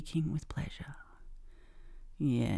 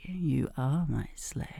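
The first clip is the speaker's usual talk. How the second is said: ordinary delivery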